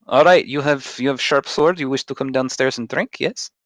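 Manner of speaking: put-on innkeeper with a Russian accent. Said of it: "the accent's just going away".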